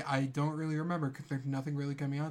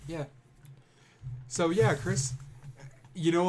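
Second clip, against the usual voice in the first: ordinary talk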